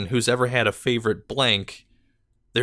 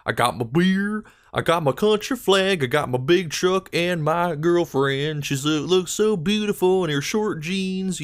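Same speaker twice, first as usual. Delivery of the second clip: higher, slow, drawn-out parody of a country song; mock country singer; 6 semitones higher than usual. Mocking country music to viewers, hammed-up singer voice. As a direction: lampoon country lyrics with a goofy twang.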